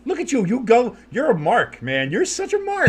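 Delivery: high teasing sing-song; mocking high voice. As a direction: tease him in a high squeaky voice. Teasing friend in wrestler voice.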